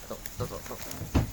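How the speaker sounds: whispering. Real, not acted. Fiddling with the phone.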